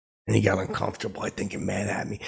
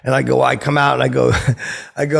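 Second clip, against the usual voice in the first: normal talking voice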